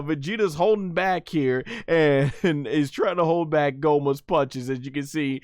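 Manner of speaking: ordinary talk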